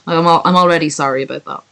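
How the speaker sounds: ordinary delivery, nothing marked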